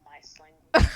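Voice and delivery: loud mouse squeak voice; squeaky mouse talk. Shown as a demonstration.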